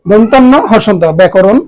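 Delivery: normal talking voice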